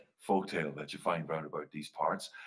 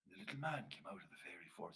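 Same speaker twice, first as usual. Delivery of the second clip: usual speaking voice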